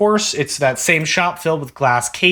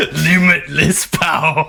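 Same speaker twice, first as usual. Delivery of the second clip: mock villain; slower than usual. Mock villain echo of own line.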